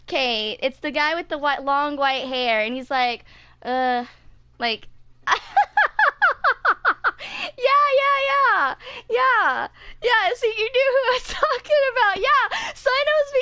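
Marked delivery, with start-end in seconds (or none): 5.3-13.4 excited laughter. Real, not acted. Delighted the friend knew the actor.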